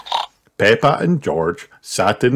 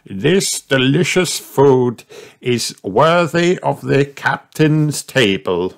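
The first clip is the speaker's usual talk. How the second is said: put-on grand old grandpa voice; 7 semitones higher, slower than usual.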